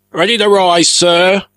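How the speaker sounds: high, loud call. A narrator's reading. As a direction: shout it across the deck. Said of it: "came the cry".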